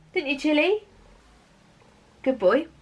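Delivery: light pet-talk aside; talking to the dog. Real, not acted. Praising her dog Chili mid-story, light pet voice. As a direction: coo a quick aside at the dog.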